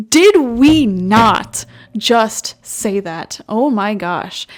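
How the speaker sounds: loud, drawn-out amazement, word by word; incredulous and slow, really hammering it; astonished disbelief. Real, not acted. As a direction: stretch it out emphatically, stunned by the coincidence. Amazed the card echoes her reading.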